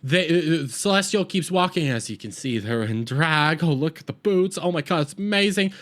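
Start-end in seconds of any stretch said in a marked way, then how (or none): none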